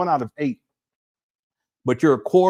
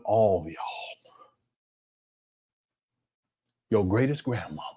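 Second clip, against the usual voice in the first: hushed reveal; 8 semitones lower, 7 dB quieter than usual. Real, not acted. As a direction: drop to a whisper, then trail softer. Dramatic close on ancestry.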